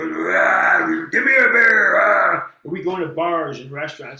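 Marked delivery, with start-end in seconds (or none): 0.0-2.5 loud growling monster shouting for a beer. Acted. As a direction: bellow it like a costumed monster in a bar. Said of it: "you couldn't break character".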